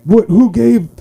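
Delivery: high, loud baffled outcry; confused disbelief. Acted. Smaller guys outrun by the big man, confused. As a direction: act out baffled amazement. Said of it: "they were confused".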